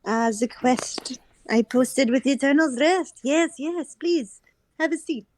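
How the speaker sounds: put-on lightly lilting French hostess accent. Hostess seating the man who answered her quest, French accent. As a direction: welcome a guest in a French accent. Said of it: "poor French accent".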